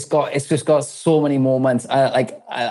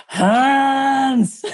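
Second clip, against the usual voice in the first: high, drawn-out mock call; 11 semitones higher than usual. Film hero hailing the villain in a retelling, mock call. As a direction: stretch the name out in a hammed-up holler.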